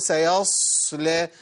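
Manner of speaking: exaggeratedly prolonged ص in "سيصلى"; overlong ص, wrong. Shown as a demonstration. Teacher showing an expected mistake, "سيصلى" with overheld ص. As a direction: stretch the ص hiss in "سيصلى" far too long before the ل. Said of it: "إن أنا يزود الزمن".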